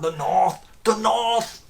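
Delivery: high, exclaimed Scouse NORTH; exaggerated north Liverpool accent. Shown as a demonstration. Guide fluffing his take and repeating it, exaggerated Scouse. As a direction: pitch way up on a mock north-city Scouse. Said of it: "I don't know why I keep doing that".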